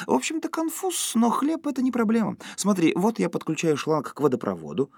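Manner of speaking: very high, breezy female character; merry, high-pitched reassurance; cheerful. A narrator's reading. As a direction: chirp it, brushing the problem off. Шейла весело.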